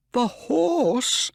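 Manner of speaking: high, loud shocked question; shocked cry. A narrator's reading. Owner crying out at the horse being the killer, high and loud. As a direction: cry out in disbelief. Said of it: "cried Mister Ross".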